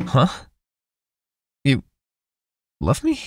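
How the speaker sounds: a loud, high jolt of surprise; startled surprise. Acted. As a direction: caught off guard by a confession. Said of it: "That was too loud".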